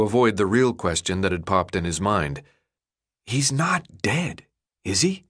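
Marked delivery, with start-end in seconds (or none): 3.2-5.2 fearful hush. A narrator's reading. Thomas fearful at sickbed.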